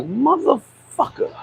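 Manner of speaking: very slow, soft, under the breath; slow soft disgusted mutter; muttered curse. Retold or imitated. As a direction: drag it out quietly in dismay. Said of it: "And I'd be like".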